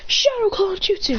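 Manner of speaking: high-pitched technique call; called-out attack. Retold or imitated. Outnumbered ninja announces his clone move, high calling voice. Character calls out attack name.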